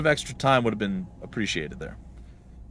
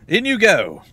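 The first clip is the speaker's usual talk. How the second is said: raised, loud jailer voice; guard's loud order; 8 semitones higher, 12 dB louder, slower than usual. Acted. Guard putting a prisoner in a cell, loud command. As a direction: push them in with the voice.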